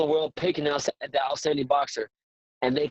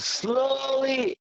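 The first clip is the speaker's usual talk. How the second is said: long, slow-motion "slowly" tracing the movement; drawn-out slowly; 10 semitones higher than usual. Retold or imitated. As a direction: stretch "slowly" right out, higher. Acts out slow move into bag.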